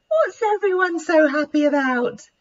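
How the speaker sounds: just woken and puzzled; sleepily. Acted. Esther wakes and asks the friends, a touch sleepy. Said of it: "she asked sleepily".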